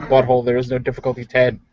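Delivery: usual speaking voice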